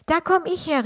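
a cardinal exclaiming in sudden surprise; surprised exclamation. A narrator's reading. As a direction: blurt it out, caught off guard. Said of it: "rief der Kardinal überrascht".

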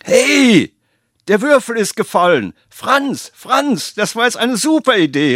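high, loud eureka shout; excited call. Acted. The cook calls to his son Franz, thrilled and high. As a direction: call out to the boy thrilled by the idea. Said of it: "rief er seinem Filius zu".

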